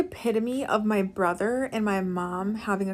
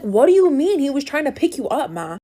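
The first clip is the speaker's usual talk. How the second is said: loud, raised-pitch angry brother; increasingly angry; 4 semitones higher, 8 dB louder than usual. Retold or imitated. Brother confronts mom about a man trying to pick her up, angry. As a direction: demand it, exasperated, as an angry son. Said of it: "my brother's getting increasingly angry".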